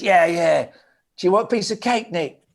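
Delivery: high, pushy cake offer; mock-eager bandmate; eager mate imitation. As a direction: chime in keenly, pitched well up. Jazz eagerly chimes in, acted.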